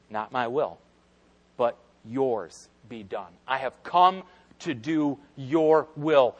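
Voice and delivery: soft, slow submission; hushed and yielding; quiet surrender. Retold or imitated.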